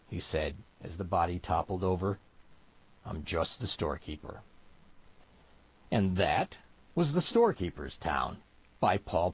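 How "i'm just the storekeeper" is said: low, soft, measured reply; understated cold finality. Acted. Storekeeper over the toppled body, quiet cold finality. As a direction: quietly deliver the last word.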